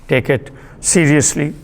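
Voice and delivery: usual speaking voice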